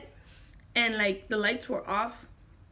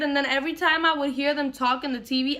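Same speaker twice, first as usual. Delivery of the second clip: ordinary talk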